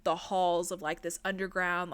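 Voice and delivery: normal talking voice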